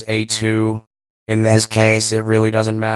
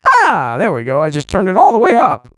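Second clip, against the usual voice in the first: high vocoder voice; 6 semitones higher, 4 dB louder than usual. Shown as a demonstration. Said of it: "the tracking is now less accurate".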